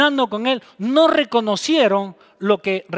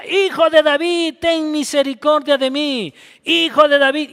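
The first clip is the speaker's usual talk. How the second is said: desperate cry; 5 semitones higher than usual. Acted. Blind beggar calling out to Jesus, pleading shout. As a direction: cry out for mercy over the crowd. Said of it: "Y cada vez gritaba más".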